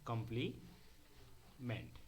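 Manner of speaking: Complement very slow and soft, long pause before meant; dictating in pieces. Shown as a demonstration. Teacher writing out the term for students, dictating in pieces. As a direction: softly dictate कॉम्प्ली, wait, then meant.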